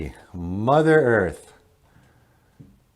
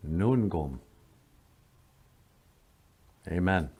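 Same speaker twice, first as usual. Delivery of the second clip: solemn prayer. Acted.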